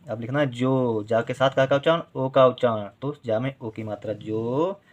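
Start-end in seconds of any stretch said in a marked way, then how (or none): none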